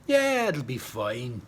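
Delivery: slow, easy offhand reply; Jock. A narrator's reading. Jock reassuring Fleur about the gun, slow and offhand. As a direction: shrug it off slowly, unconcerned. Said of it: "Jock replied".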